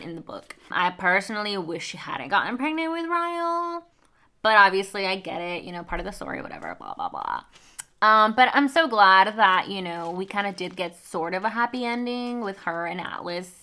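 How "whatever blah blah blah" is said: low, trailing "whatever, blah, blah"; dismissive. Real, not acted. Reader brushing off the plot twist, dismissive and low. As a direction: wave it away in a dropped-down mumble.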